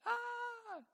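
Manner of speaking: put-on sudden high-pitched cry of a hurt toddler; child's wail. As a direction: imitate a little boy's piercing cry. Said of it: "I mean, really loud".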